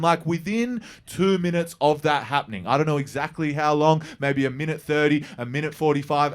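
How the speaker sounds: ordinary talk, nothing marked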